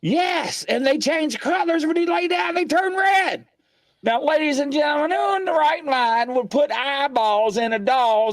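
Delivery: high-pitched, worked-up exclaiming; real high-pitched astonishment at something creepy; incredulous outburst. Real, not acted. Ranting at creepy colour-changing doll eyes.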